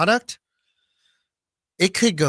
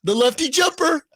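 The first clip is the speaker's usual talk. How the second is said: hype call; 12 semitones higher than usual. Pretend. Continues hype.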